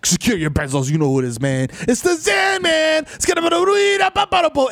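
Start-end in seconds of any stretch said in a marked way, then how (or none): 1.9-4.7 shouted entrance call, pitched high; hype shout. Acted. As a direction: announce yourself like a ring entrance. Hype self-introduction, shouting.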